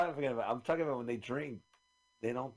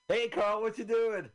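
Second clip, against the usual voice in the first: greeting, pitched up and loud; high excited greeting; 11 semitones higher, 7 dB louder, slower than usual. Pretend. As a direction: call out high and loud like a cartoon character. Acting a duck hunter scene.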